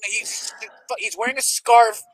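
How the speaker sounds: usual speaking voice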